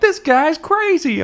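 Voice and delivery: very high, stretched-out mock cry; mock reaction. Onlookers reacting to the rhymer, high mocking voice. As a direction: squeal it slowly, way up high. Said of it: "they're all like".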